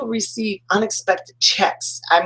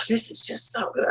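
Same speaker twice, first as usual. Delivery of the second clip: soft, airy relief after a sigh; blissful contentment; 5 semitones higher, 7 dB quieter than usual. Retold or imitated. Going with the flow, soft blissful contentment. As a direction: savour it softly, at peace. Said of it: "blissful, joyful kind of feeling".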